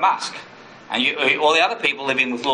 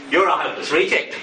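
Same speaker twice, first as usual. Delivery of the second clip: accusing inner voice. Acted. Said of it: "That's not Jesus' voice".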